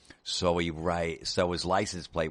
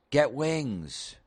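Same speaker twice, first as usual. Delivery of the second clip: "Get wings." called out higher, as a party catchphrase; mock yell; 8 semitones higher than usual. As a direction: fling "Get wings." across the room like the guy who always says it. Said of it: "I'm always the guy that yells".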